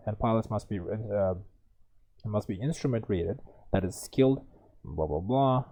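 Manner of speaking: usual speaking voice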